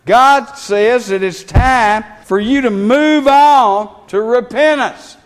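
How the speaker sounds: loud, pressing pulpit delivery; preacher urging the church to move on to repentance; fervent preaching. Acted. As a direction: drive the call home, word by word.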